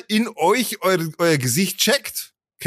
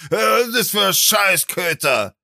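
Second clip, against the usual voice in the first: put-on indignant cursing; hammed-up outrage at a dog; mock angry outburst; 3 semitones higher than usual. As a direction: act out overreacting with a rant. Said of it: "aber nicht".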